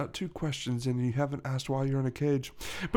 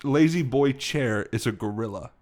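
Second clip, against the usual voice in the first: normal talking voice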